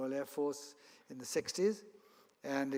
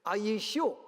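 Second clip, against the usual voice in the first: higher-pitched South African pilot voice; South African accent; 6 semitones higher, 4 dB louder, slower than usual. Acted. Pilot double-checking with his trainee, South African accent. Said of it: "try and use my best South African accent".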